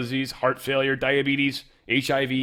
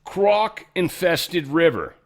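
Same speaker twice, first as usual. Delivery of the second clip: normal talking voice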